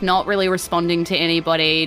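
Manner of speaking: normal talking voice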